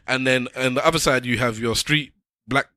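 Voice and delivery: ordinary talk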